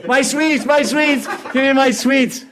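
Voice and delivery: high-pitched comic wailing yell; robbed man's shrill, loud tirade, acted out. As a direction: shout loud and high in hammed-up outrage. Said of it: "and he's yelling".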